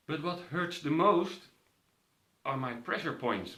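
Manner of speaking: usual speaking voice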